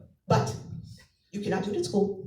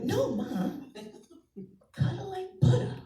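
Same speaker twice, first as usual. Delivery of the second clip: put-on kid voice; 8 semitones higher than usual.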